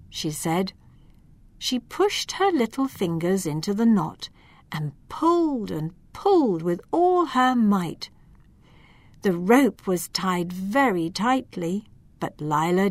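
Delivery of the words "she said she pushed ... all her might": plain reading voice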